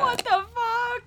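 very high, drawn-out shocked exclamation; appalled disbelief. Real, not acted. Hearing about an unhealthy incel group, real appalled disbelief. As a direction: stretch it out slowly in high-pitched disbelief.

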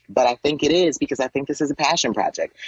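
ordinary delivery

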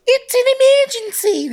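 very high, loud, slow mock kid; piercing, drawn-out child impression; Simpsons kid. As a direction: shriek it as a cartoon child with a bad Aussie accent. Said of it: "this kid goes".

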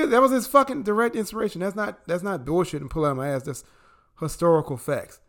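ordinary delivery

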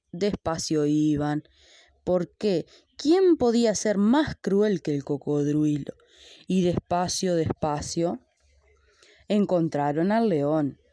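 ordinary delivery

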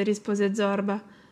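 usual speaking voice

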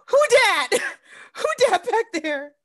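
alarmed who dat. Retold or imitated. Spotting a shadow figure in video.

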